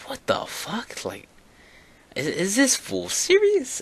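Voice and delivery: high-pitched disbelieving retort; incredulous. Retold or imitated. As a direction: squeak it out in sheer disbelief. Acted incredulous reaction to principal.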